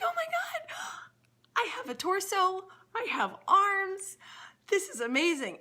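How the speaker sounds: high, loud, slowed cry of wonder; thrilled squeal. Acted. Bill squeals to the bartender, thrilled by his arms. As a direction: squeal each word out in delight.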